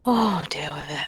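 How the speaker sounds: whispering. Real, not acted. Dismissive whispered retort.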